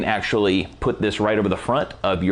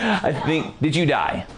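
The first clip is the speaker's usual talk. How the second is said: laughing; 2 semitones higher than usual. Real, not acted. Laughing at helper.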